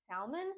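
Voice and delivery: salmon with the L sounded, SAL-mun; Southern US pronunciation. Shown as a demonstration. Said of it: "most often hear the pronunciation of salmon as".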